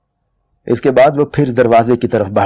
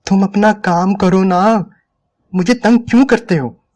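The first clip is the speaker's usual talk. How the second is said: pleading irritation; 9 semitones higher than usual. A narrator's reading. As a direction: drag it out, worn down and annoyed. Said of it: "वो झल्ला गई". (थकी, झुंझलाई हुई ऊँची गुहार)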